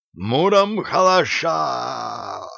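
slow, high threatening war cry; menacing shout. A narrator's reading. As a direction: bellow it slowly at the enemy. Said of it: "грозно закричал последний из бандитов".

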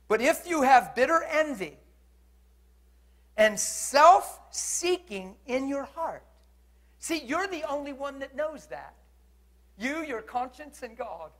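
high, loud, weighted delivery; forceful preaching. Real, not acted. Preacher warning listeners about envy in the heart, forceful preaching. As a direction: drive it home to the congregation.

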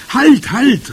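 high, loud halting cry; calling out. A narrator's reading. Sniffing witch interrupts the head witch, calling out. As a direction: shout to stop everyone. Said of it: "rief die Hexe".

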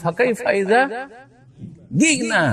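জি'না said as gina with a hard g; Egyptian reading. Retold or imitated. Teacher imitating an Egyptian reciting the Quran. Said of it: "এখন এক মিশরি যদি বলে".

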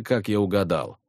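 ordinary delivery

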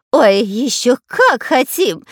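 high, slow, eager whine; whining. A narrator's reading. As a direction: whine it greedily, drawn out. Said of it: "заскулил Пулькин".

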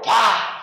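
loud shouted "pa" of a blow; explosive sound-effect burst; imitated impact. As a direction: shout out the smack of the kick. Imitates being kicked off the ground.

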